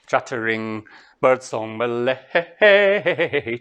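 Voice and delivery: stretched slowly, word by word; slow deliberate recitation; slow, drawn-out. Shown as a demonstration. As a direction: savour each word slowly. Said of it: "That was how I translated it".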